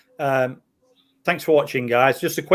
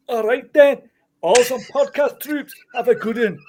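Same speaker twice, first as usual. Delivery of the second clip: high-pitched, hammed-up Scottish accent; 10 semitones higher, 3 dB louder than usual. Reading a listener's comment to the co-hosts in a hammed-up Scottish accent. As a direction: ham up a squeaky Scottish voice, laughing into it. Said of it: "In a Scottish accent".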